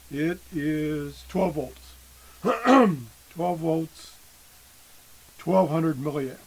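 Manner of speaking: losing voice, hoarse and strained; hoarse throat, voice giving out. Real, not acted. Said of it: "I'm losing my voice again already".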